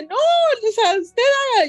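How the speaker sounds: high, firm urging; high and resolute; Kyoko insisting. Acted. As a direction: knight telling Milo to go ahead.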